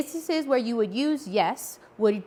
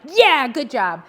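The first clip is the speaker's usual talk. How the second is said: brighter and louder "Yeah, good job"; green-light praise; 4 semitones higher, 7 dB louder, slower than usual. Shown as a demonstration. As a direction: cheer like giving a green light. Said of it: "sounds like a green light".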